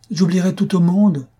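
high, loud cry through sobs; sobbing cry. A narrator's reading. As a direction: cry it out between convulsive sobs. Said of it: "au milieu de sanglots convulsifs". (cri aigu et fort entre des sanglots)

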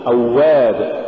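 أواب with a smooth doubled w; aw-wab, unbroken glide in أواب; soft flowing waw. Shown as a demonstration. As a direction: let the w run in أواب. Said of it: "قل".

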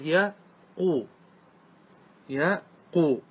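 يا قو as two parts, ya... quu; syllable by syllable. Shown as a demonstration. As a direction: sound out يا قو, pausing before long uu. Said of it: "فصارت". (تقطيع «يا قو»: يَـ، قُو)

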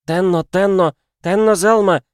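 high, breathless crystal voice; breathless girlish call, loud and high; snowflake sprite. A narrator's reading. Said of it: "прозвучал хрустальный голосок ... сбивающийся и задыхающийся".

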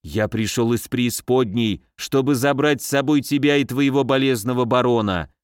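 ordinary delivery

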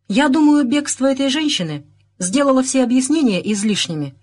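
mildly curt and final; curt retort. A narrator's reading. Inspector refusing to explain himself, mildly curt. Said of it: "отрезал инспектор".

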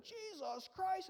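high, slow drawn-out mock oath; startled swearing. Frightened fisherman swears on seeing Jesus, mock oath. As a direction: blurt it as a stretched, frightened curse. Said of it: "Somebody probably swore".

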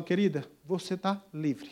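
soft, slow and gentle; tender. Acted. Man freeing the slave woman, soft and tender. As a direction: tell her gently she is free.

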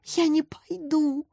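high, slow, dragged-out refusal; scared child digging in; frightened refusal. A narrator's reading. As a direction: refuse fearfully, stretching the words. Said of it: "с ужасом прошептала Варя".

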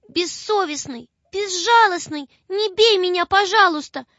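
high, pleading, close to crying; little girl pleading in tears; tearful plea. A narrator's reading. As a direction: beg the unseen beast through tears. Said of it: "опять заплакала и говорит".

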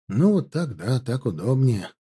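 ordinary delivery, nothing marked

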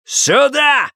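high, sharp furious shout; yelled command. A narrator's reading. Husband summoning wife without moving, yelling. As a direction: bellow the order. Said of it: "заорал он".

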